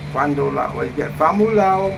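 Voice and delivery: ordinary delivery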